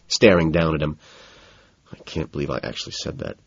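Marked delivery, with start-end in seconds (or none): 1.9-3.4 low, very soft self-directed mumble; mumbling to oneself. A narrator's reading. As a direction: mumble it privately, low and under your voice. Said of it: "I mumbled to myself".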